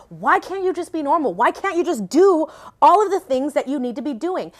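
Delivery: mild retold yell, pleading with yourself; retold shouting at herself. Berating herself at her breaking point, retold exasperated shouting. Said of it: "I was shouting at myself".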